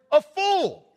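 spring the word like a shock reveal; teasing the church with the F word; punchline reveal. Acted.

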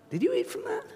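God gently catching Adam out; mock probing. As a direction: tease it out like a parent who knows.

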